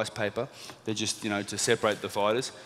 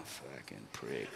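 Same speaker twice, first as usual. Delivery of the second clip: much lower, softer and slow; muttered insult; 12 semitones lower, 18 dB quieter, much slower than usual. Retold or imitated. Jealous teammates at the walkout, muttering low and soft. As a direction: grumble it under your breath.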